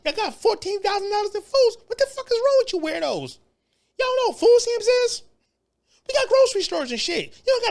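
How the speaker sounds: high, incredulous ranting at them; outraged rant. Real, not acted. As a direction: call them out in disbelief. Ranting straight at immigrants.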